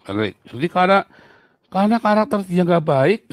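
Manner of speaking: ordinary talk, nothing marked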